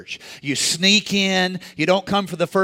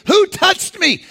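much higher, loud demanding question; insistent Jesus; 13 semitones higher, 4 dB louder, slower than usual. Acted. Jesus insisting to the crowd, loud high demand. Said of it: "Jesus insists".

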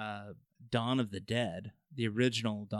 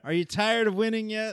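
president impression; 8 semitones higher, 5 dB louder than usual. Impersonating the president.

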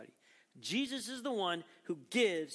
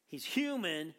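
usual speaking voice

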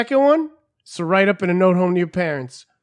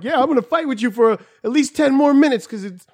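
mock defiant; 7 semitones higher than usual. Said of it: "be like".

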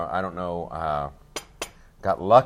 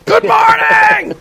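huge, high, drawn-out shout, far higher and louder; mock wake-up shout; 14 dB louder, much slower than usual. Dad shouting his daughter awake with a sword, hammed-up bellow. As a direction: bellow it like a deranged alarm clock.

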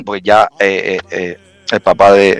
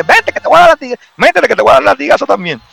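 mock-tough soldier shout, much higher; tough threat; faster than usual. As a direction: square up and threaten him with the whip, hammed up. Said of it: "me le puse ahí un poco guapo también". (amenaza de soldado, gritada en broma y muy aguda)

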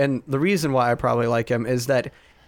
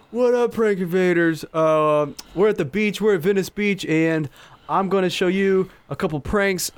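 prank YouTuber intro; 4 semitones higher than usual. Retold or imitated. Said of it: "he always starts off the, the same way".

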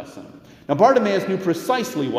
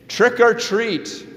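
high, slow sing-song trick or treat; kids at the door; 10 semitones higher, 5 dB louder, slower than usual. Retold or imitated. Preacher voices children at the door, high, slow and sing-song. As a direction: chant it like an eager child at the door. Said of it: "and say".

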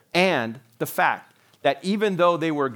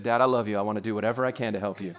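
low, fast and sweet, a touch of mock innocence; sweet son; 7 semitones lower, 4 dB quieter, faster than usual. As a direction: play the perfect son, tongue in cheek. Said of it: "His first day as a teenager, he said".

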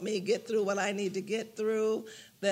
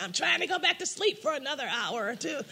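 high, laughing mock protest; giggling through a sleepy complaint; amused protest at being kept awake; 6 semitones higher than usual. Retold complaint to God, laughing.

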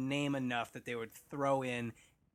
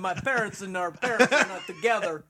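laughing, higher and louder, joking as the long-lost brother; laughing mock lament; 4 semitones higher, 8 dB louder than usual. Podcast hosts joke about brothers in the movie, laughing.

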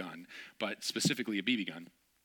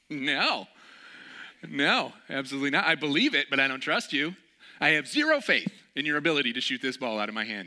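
louder emphatic no; firm refusal; 3 semitones higher, 7 dB louder than usual. Retold or imitated. Refusing the friend's dare, loud and emphatic. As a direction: refuse flatly, loudly.

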